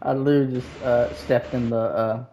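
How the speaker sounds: low, soft mumble; mumbling quietly. Real, not acted. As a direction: mumble it under your breath. Burning in game, muttering.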